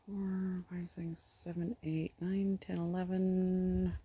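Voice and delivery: soft muttered count fading to a whisper; counting under breath. Real, not acted. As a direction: count quietly to yourself.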